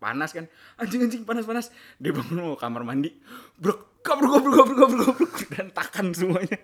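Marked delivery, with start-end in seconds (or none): 0.8-1.7 high, hurried yelp of discomfort; mock burning panic. Prankster imitates friend waking with balm on nose, mock panic. 3.6-5.3 loud, high rapid-fire tumbling noises; imitated crashing. Prankster acts out tied friends all toppling, imitated crashing.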